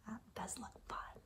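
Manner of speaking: soft fast whisper; whispered aside. Real, not acted. Commenting on the picture to children, whispered.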